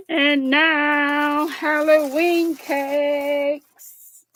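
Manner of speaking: grand slow announcer voice; drawn-out game show reveal. Pretend. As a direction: present it like a showman.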